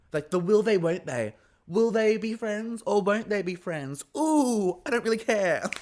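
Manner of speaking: high, loud mock-dramatic teasing; mocking suspense. Recapper mocking a friendship storyline she doesn't care about, mock-dramatic. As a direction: ham up the fake suspense.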